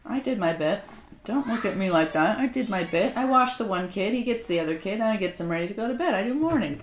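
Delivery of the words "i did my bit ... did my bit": normal talking voice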